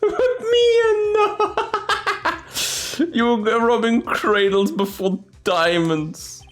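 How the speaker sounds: high-pitched laughter breaking up the words; can't finish the joke for laughing; laughing through the line. Real, not acted. Streamer laughing at a cradle-robbing joke, giggling.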